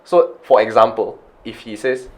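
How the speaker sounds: usual speaking voice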